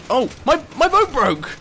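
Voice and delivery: ordinary delivery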